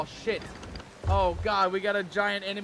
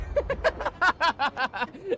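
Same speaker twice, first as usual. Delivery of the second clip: high, helpless laughter; laughing; 6 semitones higher than usual. Real, not acted. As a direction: laugh it off in defeat. Laughs as ship is blown up.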